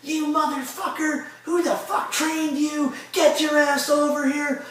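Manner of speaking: slow, very high-pitched tirade; drawn-out, high mock yelling; retold furious chewing-out. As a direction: imitate an irate boss dressing someone down. Retold chewing-out after the match.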